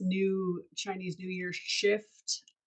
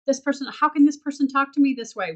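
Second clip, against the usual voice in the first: high, loud, fast indignant whine; huffy, rushing outrage at being spoken to; indignant mock outrage; 7 semitones higher, 9 dB louder, faster than usual. Mocking someone's outraged reaction to a rude person.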